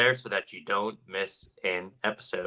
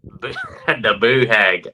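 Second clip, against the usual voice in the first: laughing southern; 8 dB louder, slower than usual. Shown as a demonstration. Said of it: "gotta say it with that southern, southern flair".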